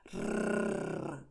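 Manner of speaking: slow rolled rrrr like a toy car motor; engine noise. Shown as a demonstration. Teacher imitating a child's toy car, rolled rrrr. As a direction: rattle the tongue like an engine. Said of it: "el sonido del motor del coche como rrrr".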